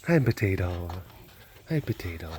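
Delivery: low, soft hello; tender pet greeting. Real, not acted. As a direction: greet a beloved pet gently.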